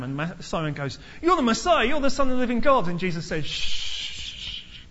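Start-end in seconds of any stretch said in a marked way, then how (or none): 1.2-2.9 high, loud eager blurt; eager Peter. Retold or imitated. Peter blurting his answer to Jesus, high and eager. 3.4-4.8 hushing. Retold or imitated. Jesus hushing Peter.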